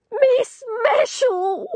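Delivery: put-on high, slow, tearful cry of an old woman; weeping old woman. Old landlady sobbing to her rescuer. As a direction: wail her name through tears, old and frail. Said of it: "She was weeping".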